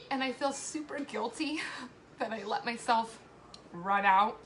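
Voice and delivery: usual speaking voice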